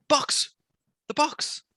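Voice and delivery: high-pitched, urgent exclamation; sudden high burst of excitement; excited realisation. Real, not acted. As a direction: blurt it out as the idea hits. Sudden recollection, after a gasp.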